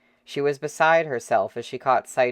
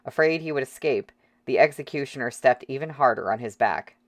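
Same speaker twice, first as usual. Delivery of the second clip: plain reading voice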